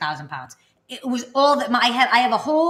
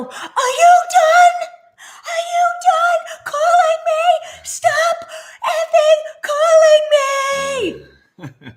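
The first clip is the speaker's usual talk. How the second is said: much higher, louder, slow screaming rant; furious scream; 8 dB louder, much slower than usual. Retold or imitated. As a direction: lose it completely on the caller. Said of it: "I flip my lid on this lady".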